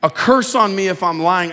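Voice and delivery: loud, high sworn curse; swearing an oath. Retold or imitated. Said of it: "that Peter swore".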